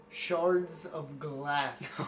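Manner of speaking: drawn-out, dry delivery; slow deadpan. Acted. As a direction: let it land slowly. Joke rating unit, slowed.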